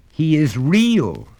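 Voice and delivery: slow, high, loud insistence; the boy defending his friend; passionately. Acted. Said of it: "said the little boy passionately".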